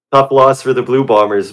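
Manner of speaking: said with a smile; smiling. Real, not acted. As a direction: grin through it, not really sorry. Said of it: "I'm smiling as I say it".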